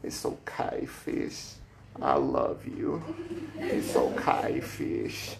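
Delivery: put-on slow hushed little-girl comfort; imitating a little girl. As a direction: soothe it like a small child cradling a fish. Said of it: "she says it over and over again".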